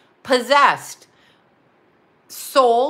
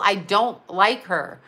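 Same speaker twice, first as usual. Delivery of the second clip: ordinary talk, nothing marked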